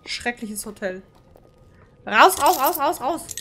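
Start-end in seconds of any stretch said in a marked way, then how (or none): 2.0-3.3 panicked shouting. Real, not acted. Fleeing the hotel in panic.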